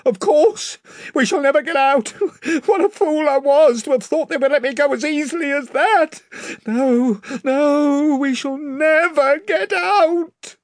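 high, loud, crazed laughing cry; a man broken by terror; manic yelling. A narrator's reading. The stranger yells at the crew in crazed despair, high and loud. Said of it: "he yelled".

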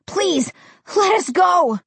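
high-pitched, drawn-out plea; desperate cry. A narrator's reading. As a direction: cry out to be released. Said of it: "Josh cried".

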